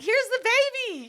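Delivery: put-on high, slow, lifted voice holding up a baby; mock ceremonial baby presentation. Friends presenting baby like Simba.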